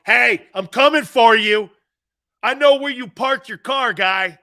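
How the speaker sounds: hammed-up heckler threatening a referee; mock threat. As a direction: menace the referee for laughs. Threatening refs from stands.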